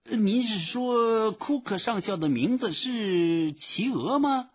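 very high, slow clerk voice; slow, high questioning official; puzzled phone clerk. A narrator's reading. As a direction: check the name in high-pitched puzzlement. Phone clerk misunderstanding the name.

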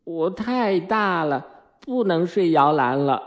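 put-on slow, high child's protest; drawn-out, high-pitched boyish complaint. A narrator's reading. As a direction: object like a little boy. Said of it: "迈克尔抗议说".

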